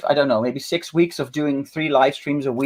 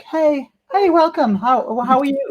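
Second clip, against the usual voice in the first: high, sweet greeting voice for the cat; cute cat voice; 11 semitones higher than usual. Pretend. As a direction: play the cat as an innocent host. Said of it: "she was just sitting there like".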